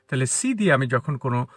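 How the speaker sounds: ordinary talk, nothing marked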